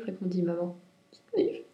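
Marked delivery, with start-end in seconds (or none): 1.3-1.6 acted little high sniffles; small mock sobs, high and short; sobbing. A narrator's reading. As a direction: sniffle like a tearful rabbit. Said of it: "sanglota Lapin".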